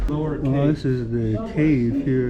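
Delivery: usual speaking voice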